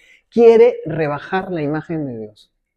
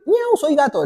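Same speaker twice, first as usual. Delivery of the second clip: cat meow; 7 semitones higher than usual. Retold or imitated. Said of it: "el señor tenía su collarín de gato".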